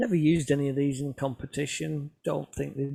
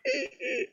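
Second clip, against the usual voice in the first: high squeaking door imitation; squeaky door. The pull door of the hide squeaks as he opens it, high squeal. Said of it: "it's going".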